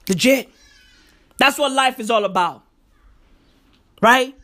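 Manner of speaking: normal talking voice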